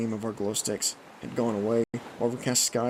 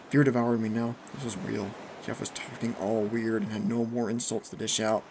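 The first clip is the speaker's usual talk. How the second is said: ordinary delivery, nothing marked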